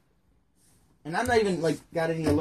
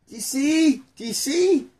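high squeak; 12 semitones higher, 6 dB louder than usual. Acted. Excited mocking pitch.

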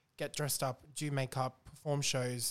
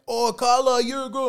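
high, loud mock squeal of recognition; gushing fan; 10 semitones higher, 12 dB louder than usual. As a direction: imitate an overexcited fan.

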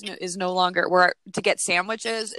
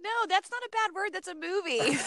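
excited; 9 semitones higher, 4 dB quieter than usual. Real, not acted. Laughing protest.

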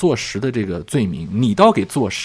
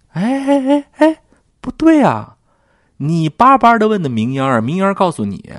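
high, loud incredulous cry of protest; mock indignant surprise; 10 semitones higher, 6 dB louder than usual. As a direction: call out the lie in disbelief. Mocking Xiren's contradiction. (高声难以置信地揭穿)

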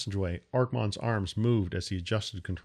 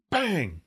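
sharp shouted bang like an impact; 8 semitones higher than usual. A narrator's reading. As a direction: punch it out like a hit on the hull. Another rock hits the ship.

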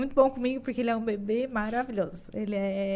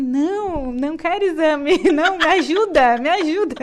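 pleading; 5 semitones higher, 9 dB louder than usual. Retold or imitated. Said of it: "E eu falava assim".

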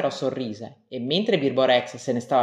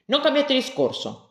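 mild rebuke; 11 semitones higher than usual. Acted. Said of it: "lo rimproverò il maestro".

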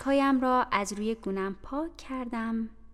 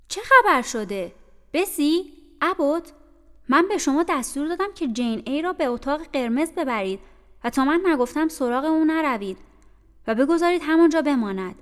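stern commanding mistress; 5 semitones higher, 9 dB louder than usual. Acted. Mrs Reed commands from the hall.

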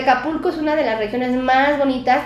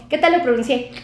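ordinary talk

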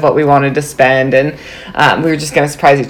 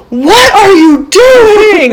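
much higher, very slow, drawn-out disbelief; husband at the shop door, much higher in slow disbelief; retold baffled husband; 4 dB louder, much slower than usual. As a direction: stretch each word out in bewilderment. Husband sees the tattoo shop.

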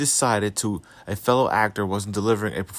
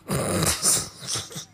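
quiet laugh; 5 dB quieter than usual. Real, not acted. Laughing at tweet.

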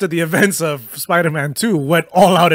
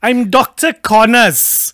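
slow, loud, higher voice; Doctor Connors impression; 5 semitones higher, 6 dB louder, much slower than usual. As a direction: announce it slowly and loudly. Acting character, slow loud.

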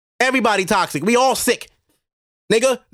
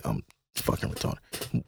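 barely voiced self-jab, slow and low; whispered aside at own typo; under-breath mutter; 13 dB quieter, slower than usual. Real, not acted. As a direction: mutter it to yourself, almost unvoiced.

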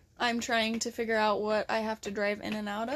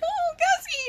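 much higher cooing of the dog's name; doting high call. Retold or imitated. Mom greeting her dog, high doting coo. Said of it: "I was like".

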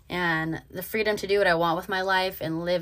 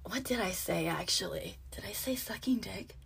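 breathy whisper, much softer; whispered aside; 11 dB quieter than usual. Retold or imitated. Asking herself what she said, hushed whisper. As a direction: whisper it to yourself in disbelief.

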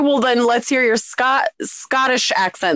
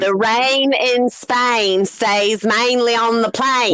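put-on drawn-out, barely intelligible British accent; slow, thick put-on British dialect; mock British accent; much slower than usual. Quoting the rain in Spain line, thick British accent. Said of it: "Like, you can barely under".

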